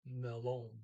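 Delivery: melon with the second syllable stressed. Shown as a demonstration. Teacher showing learners where the accent goes, second-syllable stress. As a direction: put the accent on the end of "melon". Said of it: "accent on the second syllable".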